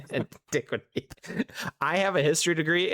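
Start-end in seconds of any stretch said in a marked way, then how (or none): 0.0-1.1 1 embarrassed laughing attempt. Real, not acted. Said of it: "never was able to say that word".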